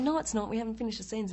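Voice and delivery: high, loud insistent no; protesting denial. Retold or imitated. Actress protesting to the makeup artist that the show isn't over. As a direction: push back in denial. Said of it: "I'm going".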